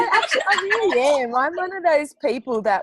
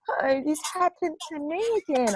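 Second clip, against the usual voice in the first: dramatic whiny voice; 8 semitones higher, 3 dB quieter than usual. Retold or imitated. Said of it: "the over dramatic person that everything is woes is me".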